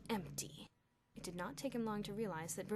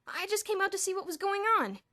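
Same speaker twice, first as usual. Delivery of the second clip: put-on upset boy; 12 semitones higher, 12 dB louder than usual. Perry explaining, upset.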